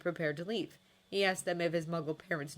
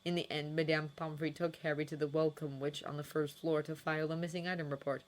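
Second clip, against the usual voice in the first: plain reading voice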